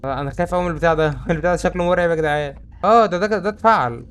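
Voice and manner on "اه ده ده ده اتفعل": excited. Real, not acted. Device activates, surprise.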